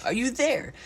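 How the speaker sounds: high call to the tiny friends. A narrator's reading. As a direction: call out into the clover.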